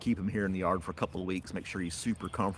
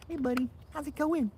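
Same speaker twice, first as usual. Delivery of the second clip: pet talk; 4 dB quieter than usual. Real, not acted. Owner greeting her dog in baby talk. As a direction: coo sweetly to the puppy.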